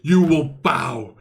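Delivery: slow, menacing overlord; commanding doom voice that stretches each word; villain voice. Acted. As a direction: drawl the threats out slowly like a gloating villain. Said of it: "He was like".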